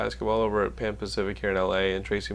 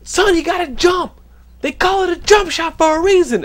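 much higher, louder yelling on the phone; Tracy Morgan yelling; 10 dB louder than usual. Retold or imitated. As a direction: rant at someone down the line like an irate celebrity. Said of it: "And then he'd go".

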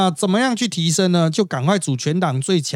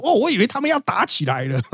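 higher-pitched mock excitement; excited retelling; 7 semitones higher, faster than usual. Cameraman telling a colleague about a near fight, mildly excited. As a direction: imitate an excited onlooker's report. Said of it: "很兴奋的跟我说".

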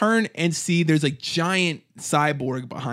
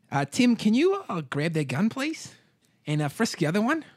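higher, softening lilting villain request; bald gang boss; 7 semitones higher than usual. Acted. Gang boss asking henchman to frisk captives, lilting villain voice. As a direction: politely hand out orders in a put-on voice.

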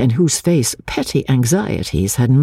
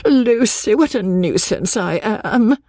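protesting; 10 semitones higher than usual. Acted. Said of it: "protested Miss Bartlett".

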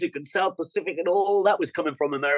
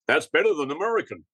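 mock American; faster than usual. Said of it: "Americans thought".

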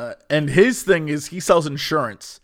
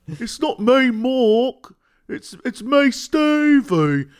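higher, exaggerated British character voice; British accent; 7 semitones higher, slower than usual. Fan imitating the Moon Knight dude, high British accent. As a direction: announce yourself in hammed-up British. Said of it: "like this British accent that is".

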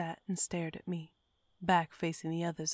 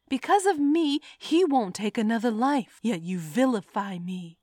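indignant outburst; 4 semitones higher, 8 dB louder than usual. A narrator's reading. Narrator defending herself angrily.

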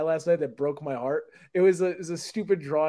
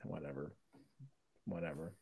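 much lower and much softer, a muttered sulk; mock sulky whatever; 11 semitones lower, 20 dB quieter than usual. Fake couple fight on stream, pouting whatever. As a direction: pretend to pout in a fake fight.

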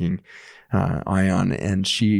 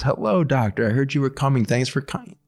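bright, higher welcome to the doctor; cheerful patient greeting; 5 semitones higher than usual. Retold or imitated. Dying patient welcomes her hospice doctor at the door, bright and cheerful. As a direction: greet him like a happy hostess, voice lifted.

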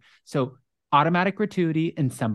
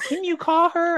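tipsy women pleading. Retold or imitated. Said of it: "they would just be like".

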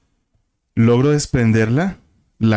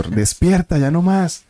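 higher retold yell of despierta; retold shout; 9 semitones higher, slower than usual. Slapping the possessed girl, yelling at her to wake. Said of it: "le pegas en la cara y yo".

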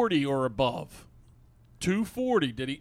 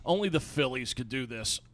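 ordinary talk

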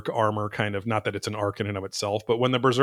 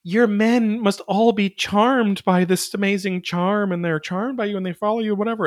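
Charlotte; 9 semitones higher than usual. Retold or imitated. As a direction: pitch it way up, gushing and mocking. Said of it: "'cause Charlotte's like".

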